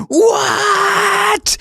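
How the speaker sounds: very high, squealed imitation; incredulous shriek. Imitating the screaming fireman sketch, high incredulous squeal. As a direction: imitate a drawn-out squeal of disbelief. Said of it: "You kept saying".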